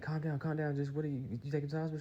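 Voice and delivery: high, hurried mother imitation; calming mom. Mama to daughter on phone, high calming imitation. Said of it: "she called her mama".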